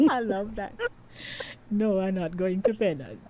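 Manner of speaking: ordinary talk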